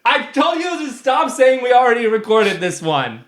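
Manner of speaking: high, loud scolding of co-host; exasperated yelling. Real, not acted. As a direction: yell it at full pitch, fed up.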